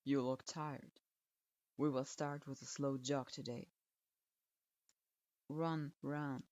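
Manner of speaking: put-on low, curt old teacher grading a student; a grudging low verdict; stern sensei voice. A narrator's reading. Strict sensei grudgingly approving his student, low and curt.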